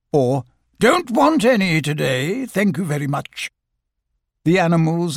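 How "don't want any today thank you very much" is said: brushing them off; dismissive Owl. Acted. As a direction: shut the door on them, Owl-style. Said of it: "he would look mysterious and say".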